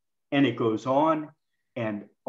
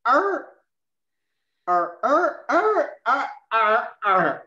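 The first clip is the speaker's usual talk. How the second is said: loud high groaning arr sounds, over and over; groans of pain; 9 semitones higher, 4 dB louder, much slower than usual. Shown as a demonstration. Coach shows the trainees what pain sounds like, loud pained groans. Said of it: "Now, pain sounds like this".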